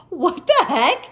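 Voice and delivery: high, loud exclamation; outraged disbelief. Retold or imitated. Driver reacting to a second ticket, outraged disbelief. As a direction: burst out in indignation.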